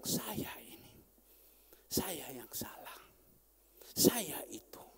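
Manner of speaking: slow unvoiced whisper of guilt; hushed slow confession. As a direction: breathe the admission slowly.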